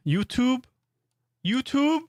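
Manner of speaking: YouTube with a high rising tune, jutub; pronunciation drill. Shown as a demonstration. Streamer drilling YouTube, rising high. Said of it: "It's not easy for me to say".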